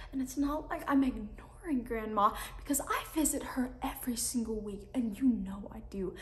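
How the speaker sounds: soft, hurt, near whisper; quiet pleading. Acted. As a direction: plead with her, barely voiced. Teen breaks down pleading to mom.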